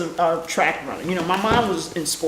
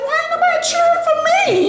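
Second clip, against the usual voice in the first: high, laughing self-pitying whine; a teen sulking mid-race; whiny complaint. Retold or imitated. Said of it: "I was like".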